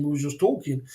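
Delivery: usual speaking voice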